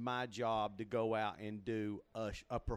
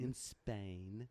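softer put-on British echo; British accent drill; 2 semitones lower, 7 dB quieter than usual. Guest repeating after the host, British elocution.

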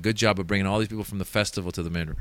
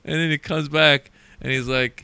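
ordinary talk

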